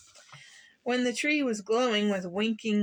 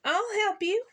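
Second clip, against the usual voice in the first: bright high friendly call, lightly voiced; 11 semitones higher, 3 dB louder than usual. A narrator's reading. Young skunk offering help to fallen puppy, friendly. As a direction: offer help kindly from above. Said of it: "said a friendly voice".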